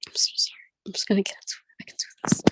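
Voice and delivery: hushed, unvoiced self-pep talk; whispering to herself. Real, not acted. Nervous poet whispering to herself before her set. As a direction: whisper it to yourself to calm your nerves.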